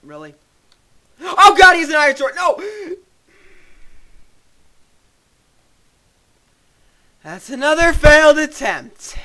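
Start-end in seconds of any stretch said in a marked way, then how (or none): 1.2-9.2 high, loud panic; panicked yelling. Real, not acted. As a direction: yell in real alarm. Gamer dies to iron sword.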